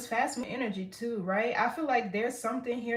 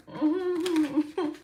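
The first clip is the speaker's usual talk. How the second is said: high laugh; 8 semitones higher, 6 dB louder than usual. Real, not acted. Laughs at card reading.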